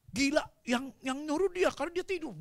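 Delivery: high-pitched acted grumble; retold crew outcry; exasperated complaint.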